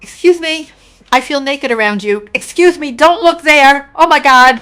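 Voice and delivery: high, loud flustered yelp; flustered exclaim. Pretend. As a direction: squeal like someone caught undressed. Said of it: "you'll be like".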